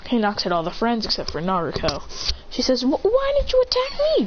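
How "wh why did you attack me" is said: put-on very high flustered girl's voice; high startled girlish question. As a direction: squeak it out like a shy girl. Sister character speaks.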